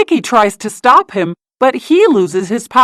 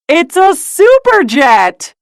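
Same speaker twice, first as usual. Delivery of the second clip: excited; 8 semitones higher, slower than usual. Acted. Discovering the mystery tool.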